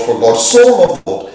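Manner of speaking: rapid low recitation; fast recital. Shown as a demonstration. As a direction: rattle off the verse from memory. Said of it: "It's just Old English".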